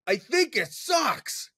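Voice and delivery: slow, high put-on voice of a rude stranger; blunt stranger. Stranger in the bathroom stall tells the speaker his opinion, slow and high. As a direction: drag it out high, like a blunt guy in the next stall.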